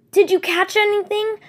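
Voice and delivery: high, loud eager call. Acted. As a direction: call out hungry for good news. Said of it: "one of them called out eagerly".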